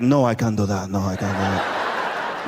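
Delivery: very low-pitched stepfather impression; stepfather's refusal. Stepfather asked to stop cheating, refusing in a low voice.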